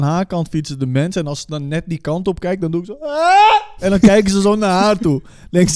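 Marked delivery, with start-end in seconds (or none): 3.0-3.8 short high prank scream; sudden high-pitched mock shriek. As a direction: yelp high out of the window. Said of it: "Het is gewoon een schreeuw".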